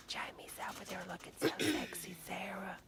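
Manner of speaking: gossipy whisper. Pretend. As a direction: whisper it behind a hand. Betty gossiping to Sarah.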